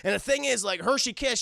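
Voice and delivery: ordinary delivery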